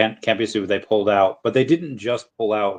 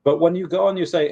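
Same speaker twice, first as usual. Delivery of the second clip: ordinary delivery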